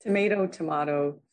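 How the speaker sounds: tomato first as to-MAY-to, then tomato as to-MAH-to; contrasting pronunciations. Shown as a demonstration. Joking on rival pronunciations.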